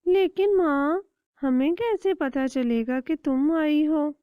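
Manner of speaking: put-on small child chick voice; little chick. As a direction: ask mother with childish curiosity. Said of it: "بولا".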